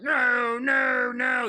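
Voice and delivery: high, drawn-out cries of "no"; imitated distressed monster wail; mock wailing protest. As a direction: howl it as if on fire, hammed up. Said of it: "and he's just like".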